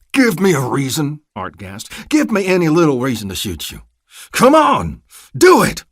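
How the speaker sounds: loud, gasping menace rising to a yell; furious threat. A narrator's reading. Art holding a rifle to the deputy's temple, furious. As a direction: dare him to move.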